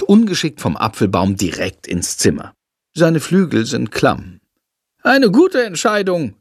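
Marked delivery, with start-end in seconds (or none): 5.0-6.4 lightly voiced high bat, approving; talking bat praising the boy. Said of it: "lobt er Jendrik".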